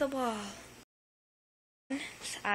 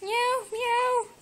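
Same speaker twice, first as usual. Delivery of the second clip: mock meow; 10 semitones higher than usual. Owner meowing back at the cat, high playful meow.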